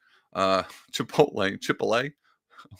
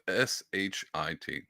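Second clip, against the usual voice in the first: spelled out; 3 semitones lower, 8 dB quieter, slower than usual. Shown as a demonstration. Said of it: "spelling it out".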